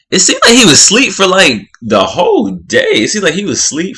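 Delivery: high, loud, incredulous; high disbelieving exclaim; amazed. Real, not acted. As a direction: marvel at how long he slept. Amazed he slept so long.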